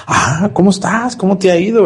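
a pleased man greeting warmly. Acted. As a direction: greet like a flattered old friend.

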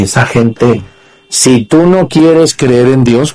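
ordinary talk

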